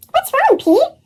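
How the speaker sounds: high, anxious question; worried girl voice. A narrator's reading. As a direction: ask with real concern. Callie finds friend panicking.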